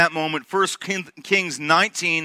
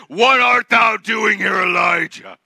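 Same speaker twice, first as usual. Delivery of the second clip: thundering rebuke; 2 semitones higher, 5 dB louder than usual. Acted. Wrathful God as he could have spoken, thundering. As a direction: boom it out in stretched, archaic grandeur. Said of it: "what he could have said".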